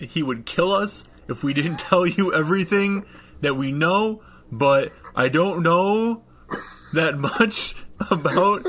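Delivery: put-on high boyish voice, trailing upward; young boy. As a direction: play a kid stammering out his story, high and hesitant. GM voicing scared young boy.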